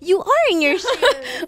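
high and loud, laughing through the words; playful laughing correction. Real, not acted. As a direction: laugh it out, teasing. Interviewer laughing.

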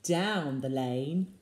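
usual speaking voice

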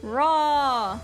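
ordinary delivery

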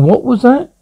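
high, rising surprised question; acted surprise, voice jumping up; startled question. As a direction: pitch it up in sudden curiosity. Reacts to a groan on phone.

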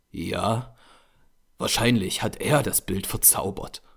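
ordinary delivery, nothing marked

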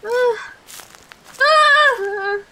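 high-pitched frightened cries; real high yelps of fear; scared yelps. Real, not acted. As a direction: cry out in panic. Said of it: "I'm so scared".

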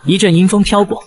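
ordinary delivery, nothing marked

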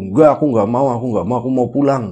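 loud, fast frightened refusal; angry refusal. Retold or imitated. Yogas refusing the ghost, loud and fast. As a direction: blurt it out, wanting to flee. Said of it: "kesel, karena marah ya".

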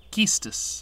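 "Quistis" with a plain K start; alternative pronunciation. Shown as a demonstration. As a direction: say "Quistis" as KEE-stis. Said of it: "not sure how to pronounce it".

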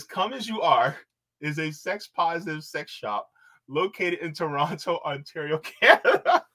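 high-pitched reading, cracking up; barely holding laughter. Real, not acted. Host reads out a sex shop co-op's description, barely holding laughter. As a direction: struggle to read on without laughing. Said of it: "it doesn't just make you laugh".

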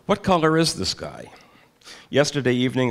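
usual speaking voice

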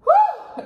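high relieved whew. Real, not acted. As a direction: blow it out, overwhelmed. Relief after spicy bite.

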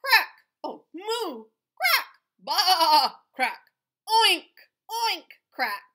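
high, loud barnyard calls; loud animal chorus. Acted. Reading to a child, loud barnyard animal calls. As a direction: belt out each animal sound. Animal noises growing louder.

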